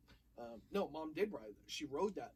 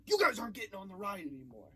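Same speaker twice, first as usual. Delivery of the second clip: mock authority; 4 semitones higher, 8 dB louder than usual. Banning rude kids from ride.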